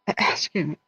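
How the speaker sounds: coughing through the words; throat caught on candy; choking on peppermint. Real, not acted. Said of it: "I'm choking on peppermint".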